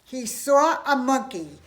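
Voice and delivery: plain reading voice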